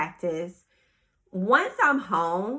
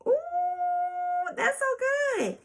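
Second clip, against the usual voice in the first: high-pitched ooh, so good; delighted squeal; slower than usual. Real, not acted. Host reacts to a quote she loves, high delighted squeal.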